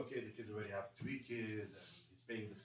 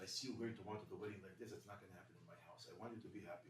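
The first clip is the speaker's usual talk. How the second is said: much softer, low murmur; hushed night talk; 5 semitones lower, 9 dB quieter, faster than usual. Retold or imitated. Father waking his son at two in the morning, hushed. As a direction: keep it down, everyone's asleep.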